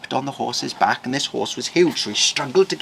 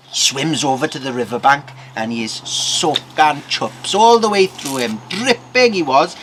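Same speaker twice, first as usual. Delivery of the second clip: ordinary delivery, nothing marked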